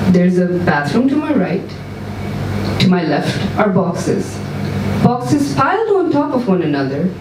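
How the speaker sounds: ordinary delivery, nothing marked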